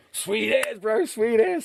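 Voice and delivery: high, eager agreement; delighted. Retold or imitated. Accepting Vern's deal, high and delighted. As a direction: jump at the offer happily. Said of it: "And I said".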